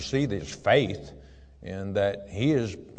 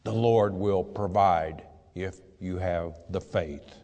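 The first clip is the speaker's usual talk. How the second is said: ordinary talk, nothing marked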